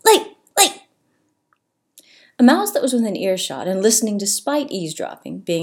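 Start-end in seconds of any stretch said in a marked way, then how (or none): 0.0-0.8 quick squawks, one after another; repeated crow shrieks. A narrator's reading. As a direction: keep screeching it like a parrot. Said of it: "Steve shrieked".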